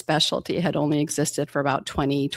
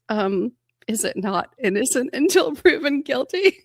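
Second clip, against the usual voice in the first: innocent question; 8 semitones higher than usual. Retold or imitated. Naive reply to the attending.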